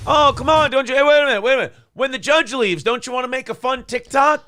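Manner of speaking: high, loud teasing jeer; mocking taunt. Real, not acted. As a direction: egg him on, loud and high. Taunting Jackson on courtroom video.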